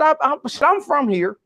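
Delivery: imitating a cowboy, high-pitched East Texan accent. Cowboy at the back cutting off the speaker, East Texan accent. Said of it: "rich East Texan accent".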